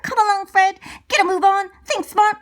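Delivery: urgent, loud send-off; shouting. A narrator's reading. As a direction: shout encouragement to a friend. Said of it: "shouts Eugene as Fred leaves".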